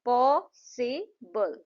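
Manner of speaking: पॉसिबल very slow, पॉ, सि, बल; syllable by syllable. Shown as a demonstration. Teacher repeats three claps in पॉसिबल, syllable by syllable. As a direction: pause after each syllable of पॉसिबल. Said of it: "पॉसिबल में तीन बार".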